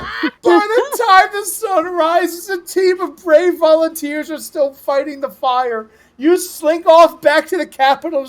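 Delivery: slow, high storyteller voice; epic narration. Acted. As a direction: tell the dawn like a saga. Narrating the aftermath.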